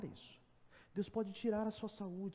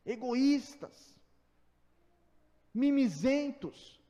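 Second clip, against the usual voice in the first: higher, louder rebuking sermon; fiery preaching; 7 semitones higher, 6 dB louder than usual. Acted. Pastor scolding believers who cry over trifles, fiery preaching. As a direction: thunder at the congregation.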